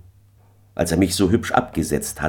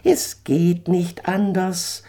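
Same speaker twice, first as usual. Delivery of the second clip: much higher, slow and unbothered; calm eagle; 13 semitones higher, slower than usual. Acted. Eagle calmly refuses Daniel, high and slow. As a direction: state it coolly as the eagle. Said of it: "sagte er ganz gelassen".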